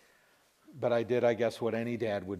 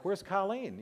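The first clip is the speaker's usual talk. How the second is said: lightly voiced boys, high questioning pitch; imitated boys; 9 semitones higher, slower than usual. Boys greeting parents at the front door, high imitated question. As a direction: ask it high like an unsuspecting child. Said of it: "the boys are like".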